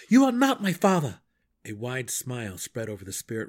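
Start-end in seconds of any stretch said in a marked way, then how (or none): 0.1-1.2 high, loud denial; angry shout. Acted. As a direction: hurl it at him. Drizzt denies the imposter.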